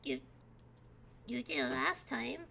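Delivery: put-on a lost kid looking around; small confused child calling out; Rad the kid. GM voices Rad.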